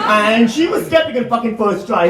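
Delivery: ordinary talk, nothing marked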